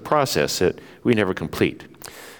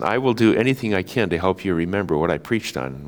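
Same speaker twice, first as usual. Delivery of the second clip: ordinary delivery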